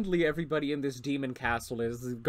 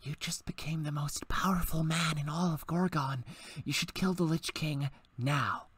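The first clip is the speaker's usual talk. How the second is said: secret whisper in the ear; conspiratorial whisper urging a kill; 7 dB quieter than usual. Acted. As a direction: lean in and whisper the plot. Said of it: "whispers in your ear".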